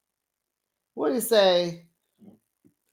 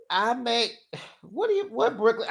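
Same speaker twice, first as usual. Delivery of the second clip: ordinary delivery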